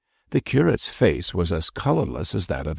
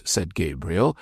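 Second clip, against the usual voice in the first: ordinary delivery, nothing marked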